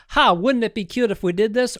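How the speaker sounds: playful imagined showrunner. Retold or imitated. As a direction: toss it out as a cute whim. Voices showrunners' frivolous idea as quote.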